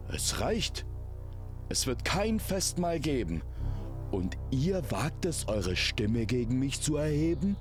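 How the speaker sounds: high-pitched, outraged king. A narrator's reading. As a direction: put your foot down, indignantly. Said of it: "Dann erhob er die Stimme".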